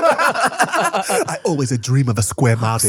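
hammed-up movie-star accent; Jackie Chan. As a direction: draw it out in a comic Cantonese-English accent. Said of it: "playing young Jackie Chan or something".

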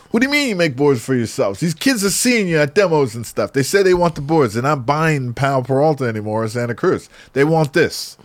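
mock-indignant caller imitation; exasperated shop owner. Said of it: "He's like".

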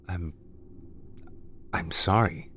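halting apology with a long break; stammering. A narrator's reading. Man apologizes to forgotten woman, mild stammer. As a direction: stumble on the first word, then apologize. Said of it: "he stammered".

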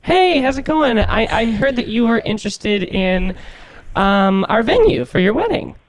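put-on college girl voice. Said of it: "try to talk like a college girl".

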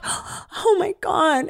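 mock the friend's dramatic shock. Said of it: "She was like".

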